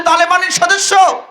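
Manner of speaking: high, fervent declamation of a woman's words; impassioned outburst. Retold or imitated. Woman journalist challenging the Taliban member, exhilarated. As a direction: address them with mounting fervour. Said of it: "উচ্ছ্বসিত হয়ে বলছে".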